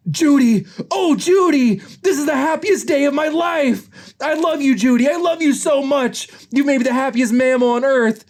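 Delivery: very high, hammed-up elation; overjoyed gushing. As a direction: gush in a high voice, bursting with joy. Nick overjoyed at becoming a father.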